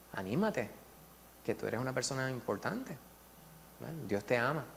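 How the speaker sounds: ánimo suave y bajito; consuelo suave. Retold or imitated. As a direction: animarlo con ternura, en voz baja. Teacher comforts sad student. (consolando al alumno triste con suavidad)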